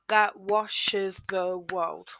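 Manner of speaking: very slow, "that washes the world" in separate beats; syllable by syllable. Shown as a demonstration. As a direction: measure out each syllable for counting. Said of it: "five syllables again".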